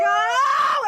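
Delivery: high, loud exclaimed "Oh!"; mock relief cry. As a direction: let out a huge burst of relief. Acted moment of huge relief.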